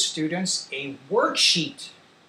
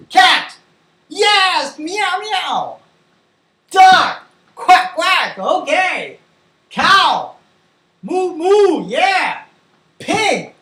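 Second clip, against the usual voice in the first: high, loud animal call-and-response; lively, high-pitched classroom drill; excited teacher game; 11 semitones higher, 11 dB louder, much slower than usual. Pretend. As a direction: fire up young pupils with each animal. Quick animal game with pretend class.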